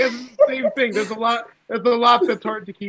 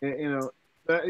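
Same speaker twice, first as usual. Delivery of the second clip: ordinary talk, nothing marked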